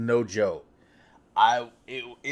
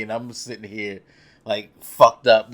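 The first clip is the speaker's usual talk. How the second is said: ordinary talk, nothing marked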